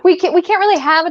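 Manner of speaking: high-pitched, gleeful dog-watcher; comically innocent high voice; over-eager mock reply. Acted reply to pet owners.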